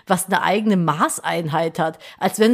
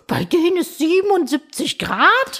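incredulous; 8 semitones higher than usual. Pretend. Shocked at Fahrenheit in films.

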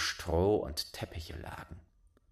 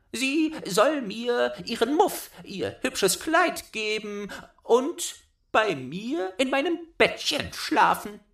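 put-on much higher, loud child voice; bossy little robber girl; 14 semitones higher, 5 dB louder than usual. A narrator's reading. Robber girl claiming Gerda, high bossy child voice. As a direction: demand it like a spoiled robber girl.

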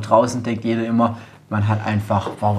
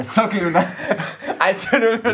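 laughing at the mix-up; 8 semitones higher than usual. Real, not acted. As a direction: giggle through the words.

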